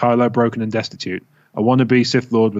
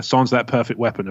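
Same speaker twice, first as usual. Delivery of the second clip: usual speaking voice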